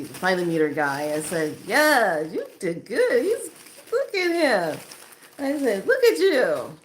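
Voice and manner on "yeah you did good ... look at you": high, gushing praise; gushing approval. Retold or imitated. Woman admiring her friend's new guy, gushing. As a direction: gush over them, pitched way up.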